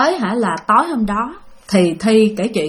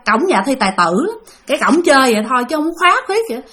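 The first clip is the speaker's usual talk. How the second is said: ordinary delivery, nothing marked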